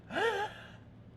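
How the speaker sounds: sharp mock intake of breath, pitched high; a hammed-up gasp before a big speech; imitated deep gasp. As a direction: gasp in like she's about to speak. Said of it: "she took a deep breath".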